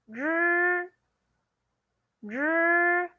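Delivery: "zhi" as a buzzing curled-tongue r, high and level; pronunciation model. Shown as a demonstration. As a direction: hold "zhi" high and even, like Mandarin ri. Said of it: "you say".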